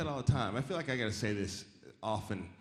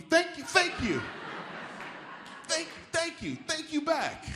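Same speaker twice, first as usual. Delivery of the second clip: high, slow, pointed "thank you"; hostile thank-you; slower than usual. Retold or imitated. Comic trading thanks like insults with locals. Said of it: "sounds a little bit like "fuck you".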